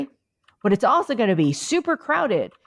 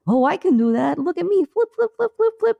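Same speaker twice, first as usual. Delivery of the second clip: put-on much higher, squeaky baby voice; baby whale; 10 semitones higher than usual. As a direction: play a proud little baby whale showing off. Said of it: "then the baby goes".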